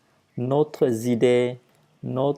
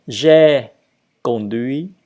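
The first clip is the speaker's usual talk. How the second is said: repeating for learners; 5 dB louder than usual. Shown as a demonstration. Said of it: "Et donc, je répète".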